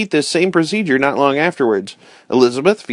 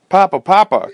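higher, louder call to his father; child crying out; 6 semitones higher, 4 dB louder than usual. A narrator's reading. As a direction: call out eagerly while running. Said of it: "cried Francis".

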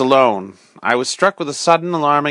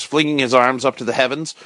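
ordinary delivery

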